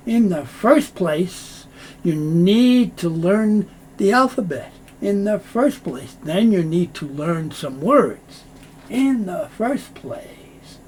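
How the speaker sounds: slow, weighty opener for the key point; emphasis on first. Shown as a demonstration. Teacher shows how to stress the top priority, slowly. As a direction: stretch out "In the first place" slowly, leaning on "first". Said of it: "we want to emphasize".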